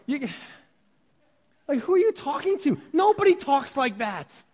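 normal talking voice

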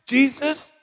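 high-pitched plea from afar; high, urgent call for mercy; crying out. Acted. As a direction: cry out to someone far off. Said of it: "In a loud voice, they're crying out".

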